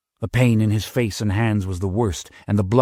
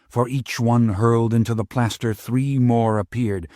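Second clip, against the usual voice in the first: plain reading voice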